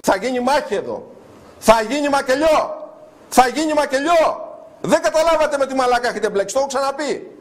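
high, ringing, threatening outburst; furious rant. Real, not acted. Show host after a quarrelsome call, furious threats.

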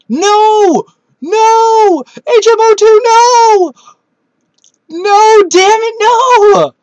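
real high, loud screams of "no"; a gamer howling as his team falls; anguished shouting. Real, not acted. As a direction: yell in raw disbelief at the loss. Losing a key Pokemon battle.

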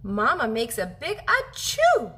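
plain reading voice

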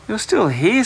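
high-pitched, astonished discovery; surprised. A narrator's reading. Boy finds the dragon still in his closet, surprised. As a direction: exclaim it high, as a surprised find.

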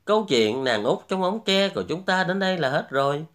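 ordinary delivery, nothing marked

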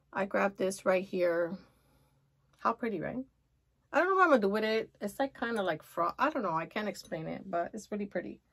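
ordinary talk, nothing marked

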